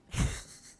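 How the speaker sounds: short breathy laugh; quick laugh to yourself; brief chuckle. Real, not acted. Laughs while chatting.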